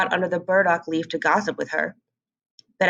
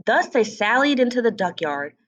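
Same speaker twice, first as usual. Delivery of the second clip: plain reading voice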